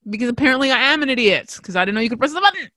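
ordinary talk

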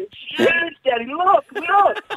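put-on high excited little-kid voice; child voice. Dad imitating his son pointing at a big nose. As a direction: squeal it like an eager five-year-old. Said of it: "he kept going on".

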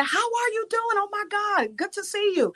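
high, bubbly retelling of his delight; excited greeting. Retold or imitated. Social worker greeting her on the train platform, high and delighted. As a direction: gush like an old friend.

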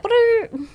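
high buzzing lip-trill fart sound; fart noise. Shown as a demonstration. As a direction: blow a raspberry like a fart. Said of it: "you know how some farts are like".

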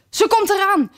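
high, excited cry of a lookout; excited larkspur call. Acted. Larkspur warns the flowers the queen comes, high excited cry. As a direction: sound the alarm, breathless and high. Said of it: "riep de ridderspoor".

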